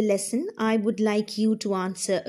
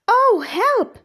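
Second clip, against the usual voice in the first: much higher, louder frightened cry; Wilma's cry for help; 11 semitones higher, 7 dB louder than usual. Acted. Wilma facing the dragon, high and loud frightened cry. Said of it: "said Wilma".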